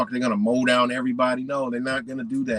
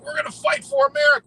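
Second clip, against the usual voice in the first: much higher, louder and fast, a sneering parody; mocking patriot voice; 6 dB louder, faster than usual. Mocking the armed patriots, high and fast. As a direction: squeak it out like a gung-ho fool. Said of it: "You know, people like".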